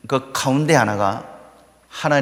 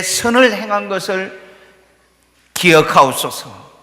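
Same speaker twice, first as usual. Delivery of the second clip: wailing prayer; 6 semitones higher, 5 dB louder than usual. Retold or imitated. Dying king Hezekiah pleading to God, loud and high. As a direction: beg God fervently to remember. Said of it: "통곡하며 기도하면서 하나님 앞에 매달립니다".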